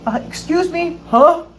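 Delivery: high-pitched inquiring fan voice; imitated approaching fan. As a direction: act out a fan interrupting someone at work. Fan timidly approaches, acted out.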